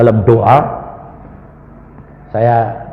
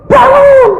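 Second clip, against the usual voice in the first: very high, loud drawn-out command; acted piercing cry; imitated scream; 12 dB louder than usual. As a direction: yell it like the wife calling her husband awake. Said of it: "Dia teriak manggil suaminya".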